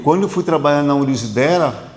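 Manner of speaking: ordinary delivery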